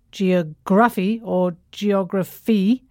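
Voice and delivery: both geography said slowly with stress on wrong syllables. Shown as a demonstration. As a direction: show two wrong stress patterns for geography.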